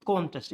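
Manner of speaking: contest as CON-test, full O; stress on first syllable. Shown as a demonstration. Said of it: "It stresses on the O".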